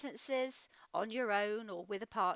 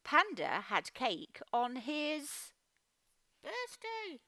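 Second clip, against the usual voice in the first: slow dictation; 4 semitones higher, much slower than usual. Shown as a demonstration. Teacher modelling an answer sentence for the class. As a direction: read it out slowly as if writing it. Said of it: "you might write".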